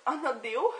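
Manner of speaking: laughing. Real, not acted. Showing viewers yet another deodorant, laughing. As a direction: break into a laugh on the last word.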